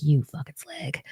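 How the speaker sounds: hushed, teasing slur; whispered mock insult. Retelling husband's nickname for her, whispered. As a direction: breathe the insult like a joke. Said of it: "Corey's other nickname for me is like".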